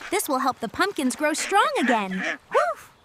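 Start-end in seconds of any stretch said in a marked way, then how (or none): 2.5-2.9 two quick barks, much higher; dog barks. Acted. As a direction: yap twice like a happy puppy.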